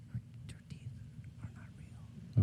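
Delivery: hushed whisper. Real, not acted. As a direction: drop to a secret whisper. Confiding mom's secret.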